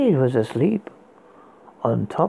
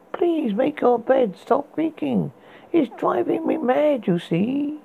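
pleading; 8 semitones higher than usual. Acted. Narrator pleads to neighbor.

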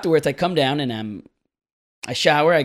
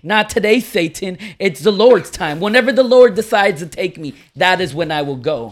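bragging; 4 semitones higher, 7 dB louder than usual. Retold or imitated. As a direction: declare it boastfully with full conviction. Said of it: "She's bragging about her".

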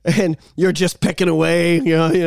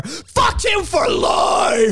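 slow drawn-out shout, much higher; mock vengeful shout; shouted curse; 8 semitones higher, much slower than usual. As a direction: stretch each word out yelling. Revenge rant.

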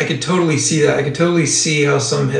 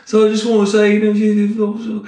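higher-pitched mock British pilot; bad British accent; 6 semitones higher than usual. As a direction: put on a clumsy British accent, stumbling to a halt. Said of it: "that's my shitty British accent".

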